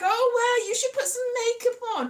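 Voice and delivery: high, pushy helpful friend. Retold or imitated. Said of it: "this very helpful friend is like".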